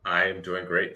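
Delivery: ordinary talk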